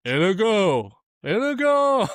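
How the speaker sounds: high, loud exaggerated French-accented Inigo; mock Andre accent. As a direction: say Inigo as a big hammed-up Andre. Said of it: "And he's like".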